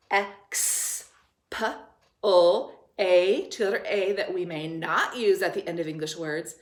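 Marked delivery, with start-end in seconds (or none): 0.1-3.5 "X, P, O, A" as separate phonics sounds; sounding out. Shown as a demonstration. Teacher breaking explain into sounds, sounding out. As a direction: sound out "X, P, O, A" piece by piece. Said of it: "We hear two syllables".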